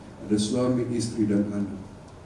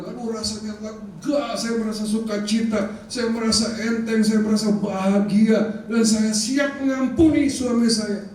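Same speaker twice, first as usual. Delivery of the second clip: retold joy; 6 semitones higher than usual. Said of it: "Sampai dia bilang".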